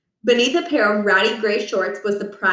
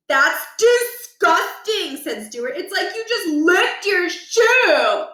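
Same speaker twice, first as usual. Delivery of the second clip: put-on disgusted kid voice; 9 semitones higher, slower than usual. Stuart disgusted, slow high.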